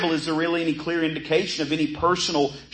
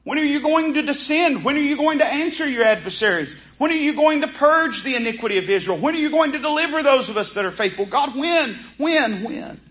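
higher, pressing cry of a desperate believer; the remnant imploring the Lord to come, voice raised high; pleading remnant; 7 semitones higher than usual. Retold or imitated. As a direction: beg God to come, each question more urgent. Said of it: "It's almost like the remnant is saying".

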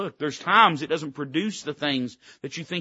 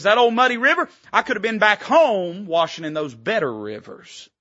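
higher, louder sneer at the river; Naaman's scorn; 5 semitones higher, 5 dB louder than usual. Retold or imitated. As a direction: sneer like a snob at muddy water. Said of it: "he says".

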